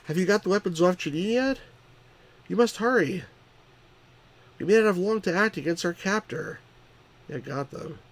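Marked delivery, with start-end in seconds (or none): 0.0-6.6 character voice. Acted. Voicing Hardy character dialogue.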